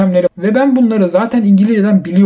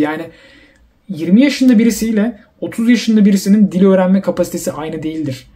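ordinary talk

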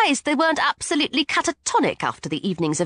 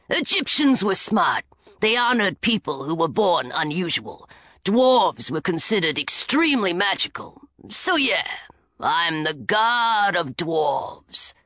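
gruff dwarf god; 5 semitones lower than usual. A narrator's reading. Dwarf explaining to the girls, low and gruff. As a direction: lecture them like a proud dwarf.

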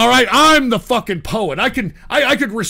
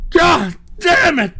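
angry shout; 6 semitones higher, slower than usual. Real, not acted. Raging at stream chat.

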